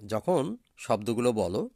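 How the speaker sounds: usual speaking voice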